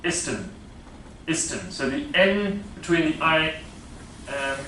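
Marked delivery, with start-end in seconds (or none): 0.0-1.7 "isten" with the N and final T dropped; "Isten" as a Spanish learner would say it; Spanish-accented instant. Shown as a demonstration. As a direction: say "isten", no N before the S and no T at the end. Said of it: "will not say instant, but".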